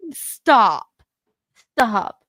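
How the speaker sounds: usual speaking voice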